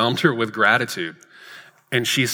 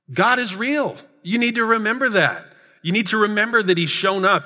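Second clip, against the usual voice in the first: rising preaching force; 9 semitones higher than usual. Real, not acted. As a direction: preach it, pitch climbing high on "real". Preacher repeating key line.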